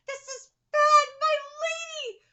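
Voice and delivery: high, loud panicked cry; alarmed retainer. Acted. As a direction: call out in alarm to your lady. Reading a worried supporter.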